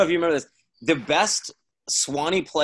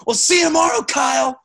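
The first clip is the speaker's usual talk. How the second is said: retold scream; 13 semitones higher, 7 dB louder than usual. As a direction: scream it across to the umpire. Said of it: "And so he screams".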